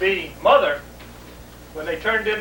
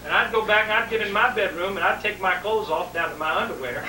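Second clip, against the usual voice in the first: ordinary delivery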